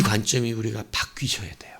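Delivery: urgent whispered appeal. Real, not acted. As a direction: lean in and whisper it to the congregation. Preacher drops to a whisper.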